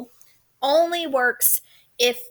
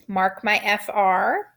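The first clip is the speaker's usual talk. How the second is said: usual speaking voice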